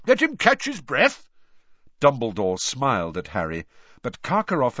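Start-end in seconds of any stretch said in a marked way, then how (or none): 0.1-1.3 high, loud jovial official; Ludo Bagman. A narrator's reading. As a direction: soothe a fussy colleague cheerily. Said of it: "said Ludo Bagman".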